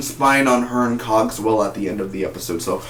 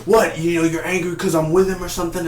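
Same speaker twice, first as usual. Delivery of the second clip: higher-pitched indignant challenge; mock angry son; 5 semitones higher, faster than usual. Angry son confronting his dad, mock indignation. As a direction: retell an angry son's outburst. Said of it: "Sam's angry at his dad".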